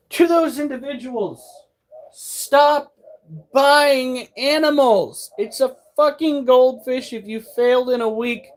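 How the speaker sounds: exasperated rant. Real, not acted. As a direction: scold them, fed up and incredulous. Ranting at goldfish killers.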